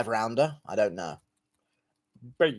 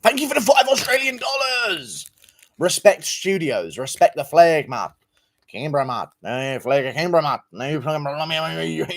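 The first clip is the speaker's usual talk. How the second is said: put-on Australian accent. Thanking an Australian donor, hammed-up Australian accent. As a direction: ham up a broad Australian twang. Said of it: "my Australian brother".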